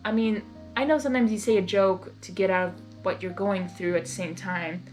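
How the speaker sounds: ordinary delivery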